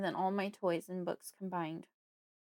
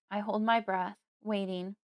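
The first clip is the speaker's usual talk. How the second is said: ordinary delivery, nothing marked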